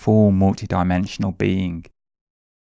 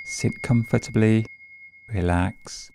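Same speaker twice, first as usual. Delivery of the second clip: guided meditation. Acted. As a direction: ease them gently into the breath. Leads a meditation.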